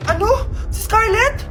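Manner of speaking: high, loud alarmed gasp of a question; shocked. Acted. Mother hearing her child is missing, shocked. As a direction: panic as it sinks in.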